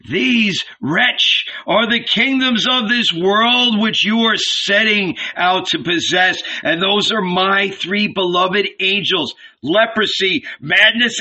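a hint of a thundering deity; booming divine voice. A narrator's reading. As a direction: pronounce doom as the voice from the sky. The voice speaks to Jesus.

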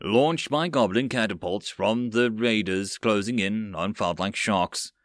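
ordinary delivery

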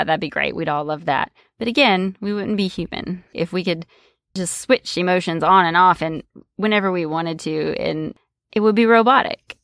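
ordinary talk, nothing marked

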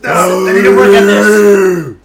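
loud slow scream. Shown as a demonstration. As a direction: scream it out, drawn long. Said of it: "screaming lesson".

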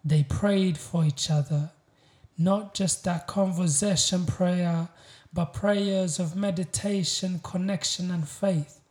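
proud, weighty spoken-word delivery; spoken-word poem. Acted. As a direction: declaim each line with deliberate pauses. Recites a poem.

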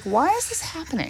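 high, soft baffled question. Retold or imitated. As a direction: ask it in disbelief. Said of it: "I was like".

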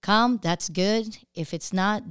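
ordinary talk

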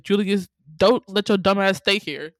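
loud mock scolding. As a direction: tell off a movie character, loudly. Acting out her reaction to film.

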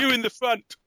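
high, loud mock golf yell; yelled warning. Golfer joking to co-host about warnings, high loud yell. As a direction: holler at the players up ahead. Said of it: "and yell".

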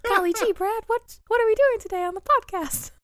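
high, loud cartoon mouse voice; chirpy Mickey squeak, full of cheer. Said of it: "I sound like Mickey Mouse".

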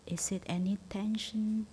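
normal talking voice

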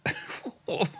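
high burst of disbelieving laughter; incredulous laugh. Real, not acted. Commentator hearing the anointing line, laughing in disbelief.

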